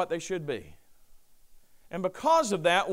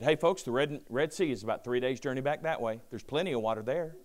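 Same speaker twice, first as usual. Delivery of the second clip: dry, lowered sarcastic aside; sarcastic retort; 6 semitones lower, 7 dB quieter than usual. Retold or imitated. Pastor imagining his sarcastic answer to the grumbling Israelites. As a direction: toss it off with a dry sarcastic shrug. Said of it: "I'm a little sarcastic".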